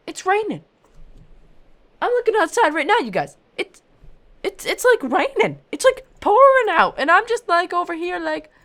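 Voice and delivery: loud, high exasperated ranting; frustrated rant. Real, not acted. Gamer ranting to viewers about rain, loud and high. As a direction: vent at the rain at full volume.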